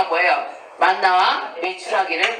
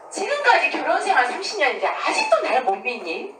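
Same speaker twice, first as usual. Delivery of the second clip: higher-pitched mock wounded plea; acted hurt; 5 semitones higher than usual. Husband to his doubting wife after thirty years, acted hurt. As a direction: plead like a hurt, mistrusted spouse. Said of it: "얼마나 그 남편이 슬프겠어요".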